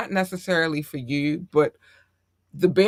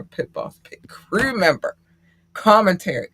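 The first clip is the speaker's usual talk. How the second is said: ordinary talk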